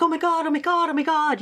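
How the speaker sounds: high-pitched, repetitive mock panic; imitated shock. Stepsister on the emergency call, imitated shocked repeating. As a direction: imitate someone stuck repeating themselves in shock. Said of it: "in a state of shock, you know, just repeating".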